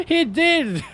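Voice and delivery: high, through laughter; laughing disbelief. Real, not acted. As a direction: giggle it out. Laughing at Jack's death.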